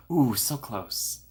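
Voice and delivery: slow, stretched-out "Ooh, so close"; teasing slow-motion "so close"; drawn-out ooh. Acted. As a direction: savour each word slowly. Reacting to a chat attempt.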